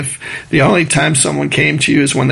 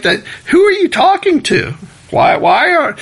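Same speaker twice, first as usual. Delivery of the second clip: mock disbelief; 13 semitones higher than usual. Mock rebuke of speed prayer.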